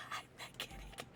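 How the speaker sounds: breathy whisper; playful secret. Pretend. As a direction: whisper it to yourself. Whispered role line.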